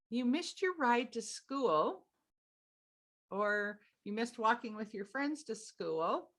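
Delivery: put-on sweet singsong delivery; sweetest voice. Shown as a demonstration. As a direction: stretch it out gently and sweetly. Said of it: "you say it in your sweetest voice".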